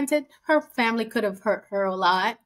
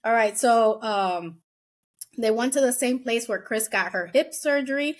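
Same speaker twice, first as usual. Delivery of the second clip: ordinary talk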